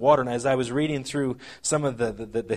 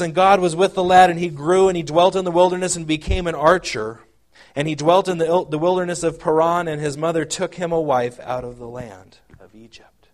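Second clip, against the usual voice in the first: louder Bible reading; scripture reading; 4 semitones higher, 5 dB louder than usual. Acted. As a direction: read the verse to the congregation. Reads scripture aloud.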